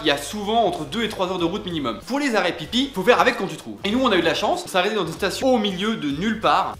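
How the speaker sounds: ordinary talk, nothing marked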